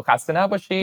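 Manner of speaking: khasta naboshid, light and high; Persian greeting. Shown as a demonstration. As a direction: say khasta naboshid warmly in passing. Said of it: "a way of saying hello".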